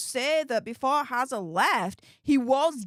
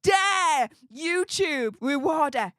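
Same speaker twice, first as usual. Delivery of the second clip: slow, higher-pitched mock indignation; imagined outrage; 5 semitones higher, much slower than usual. Streamer imagining a rival's reaction to the play button, mock outrage. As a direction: draw it out like an appalled critic. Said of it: "imagine him turning around and saying".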